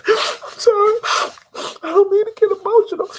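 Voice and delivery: very high, loud blubbering apology; hammed-up weepy squeak; mock crying. Woman crying to her date at the table, mock sobbing. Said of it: "homegirls at the table crying".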